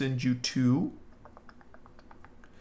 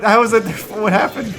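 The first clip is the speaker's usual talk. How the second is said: louder and higher, pushing over the noise; raised to be heard; 7 semitones higher, 10 dB louder than usual. Real, not acted. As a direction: repeat it louder over the clip. Repeats question over loud clip.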